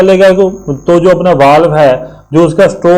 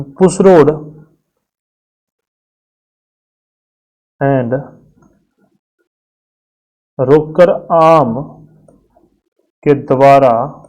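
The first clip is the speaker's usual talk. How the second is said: drawn out, pausing after each phrase; slow dictation; much slower than usual. Shown as a demonstration. Teacher dictating a less important line, slowly. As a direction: slowly spell out the phrase for note taking. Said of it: "पर लिख लेते हैं".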